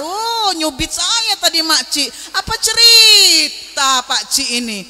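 slow, drawn-out teasing outcry; mock protest. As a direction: stretch it out as a playful complaint. Teases Pak Ci with mock protest. (protes pura-pura yang dipanjangkan)

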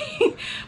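squeaky little laugh; a quick high giggle. Real, not acted. Laughs about wanting wine.

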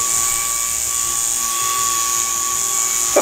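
high squealing laugh; shrieking laugh. Real, not acted. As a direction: burst into a shrill laugh. Mail opening scream laugh.

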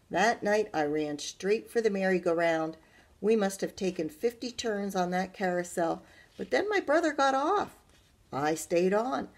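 put-on lively, loud read-aloud for children; bright storyteller voice; story narration. A narrator's reading. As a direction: read it to a class with animation. Teacher reads story aloud.

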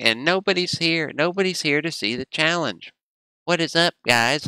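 high-pitched mock grievance; mock complaint. Jokingly complains that nobody showed up.

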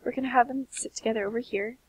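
usual speaking voice

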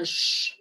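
Sh as a bare hissed r; Andean r. Shown as a demonstration. Teacher demonstrating the Andean assibilated r. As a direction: hiss the r like an Andean speaker. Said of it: "Esta es la R andina".

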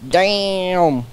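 slow Southern drawl on Damn, stretched; drawn-out southern accent. Pretend. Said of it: "You said that with such a southern accent".

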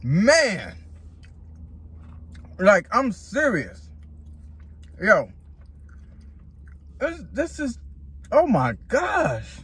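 talking while chewing; chewing between words; mouth full. Real, not acted. Seen 1: bite at -2, chews while talking, bites again near 9.

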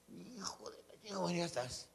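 a soft, unvoiced whisper under the breath; whispered curse. The girl curses under her breath, then gives in, whispering. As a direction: curse to yourself so nobody hears.

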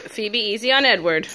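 loud warning call. Real, not acted. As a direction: call them off firmly. Calling to the rough puppies.

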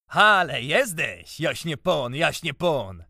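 mock him as a lordling; scornful taunting. A narrator's reading. Taunts friend as a lord.

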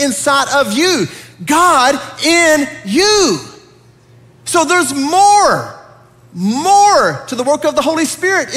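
high, ringing climax with long-held key words; full-force preaching. Real, not acted. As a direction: preach it out, stretching the big words.

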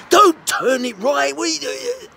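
very high, loud shout, dragging the first words; angry instructor's yell. Retold or imitated. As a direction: bellow it like a panicked, rude driving instructor. Retelling rude instructor's outburst.

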